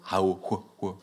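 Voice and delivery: "hao, hua, hua" with a break between each sound. Shown as a demonstration. Demonstrating Tahitian sound breaks on "hao, hua, hua", each cut off. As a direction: break "hao, hua, hua" apart in abrupt bursts. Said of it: "les ruptures so-, sonores".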